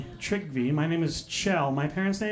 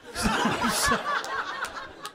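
high cackle. Retold or imitated. Mock scream after insult.

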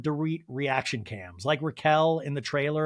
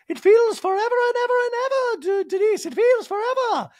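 Dorit impression; 7 dB louder than usual. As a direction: act shocked in a squeaky put-on voice. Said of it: "And Dorit's like".

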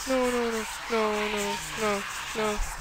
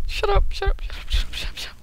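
panicked pleading; 9 semitones higher, faster than usual. Real, not acted. Pleading, fading out.

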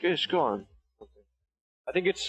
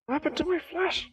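disbelieving whine. Real, not acted. Repeats in disbelief.

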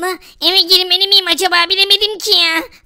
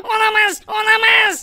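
ordinary talk, nothing marked

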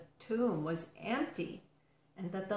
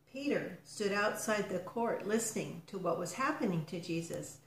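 ordinary delivery, nothing marked

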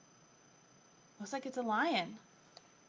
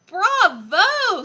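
loud, high, sung-out bravo; triumphant cheer; 8 semitones higher, 16 dB louder than usual. Acted. Lion praising the pupil's pounce, loud high cheer. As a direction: cheer it with a big lifted ending.

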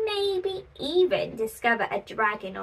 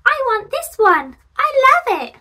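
put-on much higher, louder eager kid; excited child; 12 semitones higher, 7 dB louder than usual. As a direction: squeal it like a thrilled little kid. Child picking a pumpkin.

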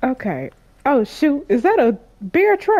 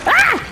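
sudden high yelp of fright; high startled scream. Real, not acted. Gamer shrieking at a headless enemy, high scream.

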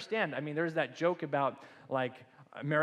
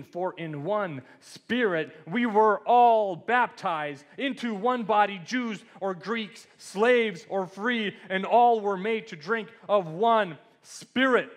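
slow, loud and raised preaching voice; emphatic scripture reading; 5 semitones higher, 7 dB louder, slower than usual. Acted. Pastor reads Corinthians to the congregation, slow emphatic proclamation. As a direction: proclaim the verse word by word, drawn out. Reads a Bible verse aloud.

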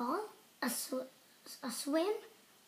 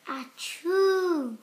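acted achoo, louder; sneeze; 6 dB louder than usual. Sneeze at the doctor.

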